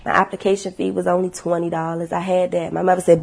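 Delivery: ordinary talk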